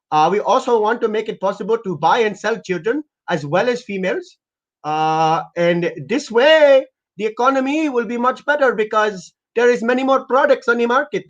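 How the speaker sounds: put-on high-pitched thick foreign accent; eager salesman in a high, put-on foreign voice; accented prank caller. Pitching an outrageous bill to a prank target, high accented patter.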